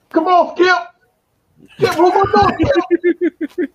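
very high-pitched, hammed-up impression; high falsetto sportscaster parody; Shannon Sharpe. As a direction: squeal it way up high, exasperated at a co-host. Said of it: "I could do a voice too".

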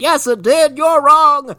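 high, loud stubborn granny; old lady voice. Retold or imitated. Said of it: "She's like".

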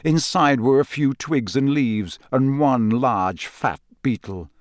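plain reading voice

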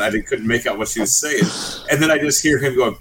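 ordinary delivery

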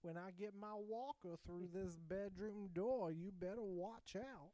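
put-on slow, high, loud old-man threat; grandpa voice. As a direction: hobble in like a menacing grandpa. Acting as grandpa.

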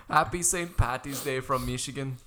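hammed-up Irish-Michigan accent, laughing; Irish-Minnesotan accent. Running with co-host's Irish Minnesotans joke, mock accent. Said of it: "Like Irish Minnesotans".

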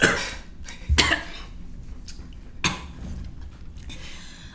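sudden hacking cough, choking on wine. Real, not acted. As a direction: cough hard as wine goes down wrong. Wine down the wrong pipe.

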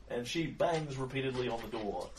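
normal talking voice

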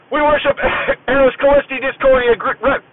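cracking up mid-recitation; breaking into laughter. Real, not acted. Retelling the inspection, he loses it laughing. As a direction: try to stay serious but burst out laughing. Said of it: "I can't even say it with a straight face now".

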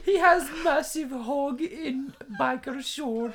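high, slow put-on fan voice; mock fan voice. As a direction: squeal it slowly as an awestruck foreign fan. Same mock fan, drawn out.

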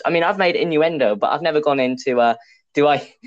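ordinary talk

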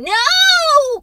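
put-on high, loud child's shout; a kid shouting no; cried out. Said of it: "cried the boy".

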